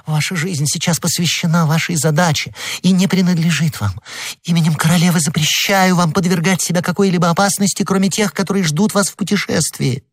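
commanding woman. A narrator's reading. Queen's command by Madame Bonacieux.

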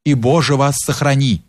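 ordinary delivery

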